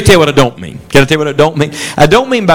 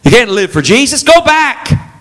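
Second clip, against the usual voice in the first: high, taunting tempter voice; Satan's voice; 8 semitones higher, slower than usual. Acted. Satan tempting the believer to turn back, high and taunting. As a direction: jeer at them to go back, pitched up high. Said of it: "Satan says".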